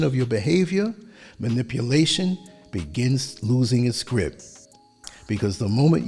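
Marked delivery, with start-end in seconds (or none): none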